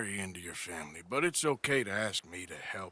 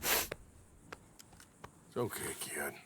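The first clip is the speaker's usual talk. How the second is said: very soft, slow, near-whispered comfort; gentle reassurance; 8 semitones lower, 10 dB quieter, much slower than usual. Acted. Arthur soothing Jamie after he lowers the gun, hushed. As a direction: ease him down softly.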